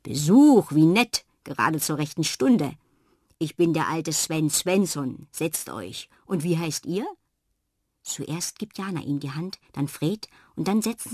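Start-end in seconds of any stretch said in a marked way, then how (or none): 0.0-7.1 hearty welcome, louder at the start; friendly old man. Acted. Sven Svensson welcomes Jana and Fred, friendly old man, loud greeting. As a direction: open arms to unexpected guests.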